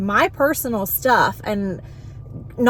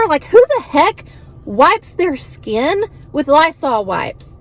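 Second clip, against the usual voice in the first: exasperated scolding; 7 semitones higher than usual. Retold or imitated. Scolding patient over the wipes.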